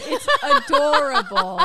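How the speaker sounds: high, loud, drawn-out gush through laughter; laughing. Real, not acted. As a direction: squeal it while really laughing. Cracking up at friend's dance.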